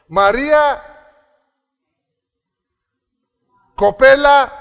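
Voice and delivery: high, loud call to a pupil; calling out a name. Retold or imitated. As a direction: call a pupil across the classroom. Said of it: "δεν ήξερα το όνομα".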